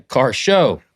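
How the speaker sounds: loud, drawn-out exclamation; high, slow announcer shout; yelled title. Shown as a demonstration. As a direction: yell the show name, stretching SHOW. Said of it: "Are you yelling?".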